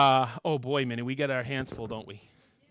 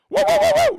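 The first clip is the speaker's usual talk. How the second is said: excited whoa; 12 dB louder than usual. Real, not acted. Surprised by a name on list.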